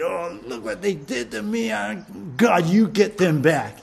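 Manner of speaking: hammed-up vengeful whine; bitter, hateful. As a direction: act out a grudge-filled plea for payback. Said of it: "filled with some bitterness and hate".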